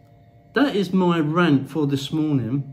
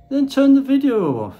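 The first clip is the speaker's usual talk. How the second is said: louder and higher, shoving critics away; annoyed outburst; 9 semitones higher, 6 dB louder than usual. Real, not acted. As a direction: snap it at the haters, real annoyance. Annoyed vlogger dares critics.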